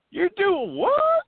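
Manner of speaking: very high, loud, slow Tennessee disbelief; Tennessee accent. Retold or imitated. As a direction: stretch it out, Tennessee style. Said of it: "I'm trying to do my best Tennessee accent".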